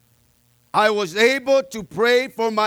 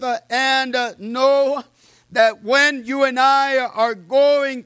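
slow, emphatic sermon delivery; preaching; 5 semitones higher, 3 dB louder than usual. Acted. Pastor encouraging the church through hardship, slow emphatic preaching. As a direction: lean into each phrase.

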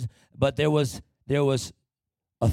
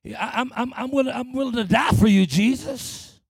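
eager stammered pledge, higher and hurried; Peter; 6 semitones higher than usual. Acted. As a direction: overeager disciple insisting on his devotion. Peter insisting he would die.

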